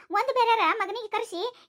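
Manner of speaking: normal talking voice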